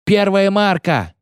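high, drawn-out sing-out; sailor's bellowed call. A narrator's reading. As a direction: call the depth across the ship. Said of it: "заорал матрос с лотом". (протяжный матросский выкрик)

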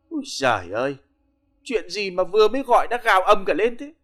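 a hint of a drunk woman whining; lightly slurred drunken complaint; slurred tipsy whine. Hungover wife drowsily complaining at the loud caller. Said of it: "Mụ Hữu lè nhè hỏi".

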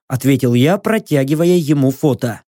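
ordinary delivery, nothing marked

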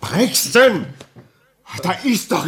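loud, high shout of his name; sharp shout. Acted. Doctor shouting at the signalman to stop, loud. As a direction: snap him out of it with a yell.

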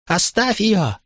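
put-on high-pitched child crying out; acted small boy yelling through tears, without extra volume; tearful boy's cry. A narrator's reading. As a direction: pitch way up like a distraught little boy. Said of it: "кричал мальчик сквозь слёзы".

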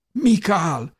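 high, loud call; crying out. A narrator's reading. Jósa calls after vanishing Mikál, crying out. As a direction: cry the name after someone leaving. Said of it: "kiáltottam".

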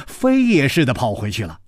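plain reading voice

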